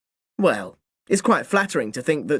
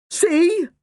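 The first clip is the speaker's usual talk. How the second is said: put-on boy crowing over his point; 13 semitones higher than usual. A narrator's reading. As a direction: gloat in victory. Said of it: "I crowed".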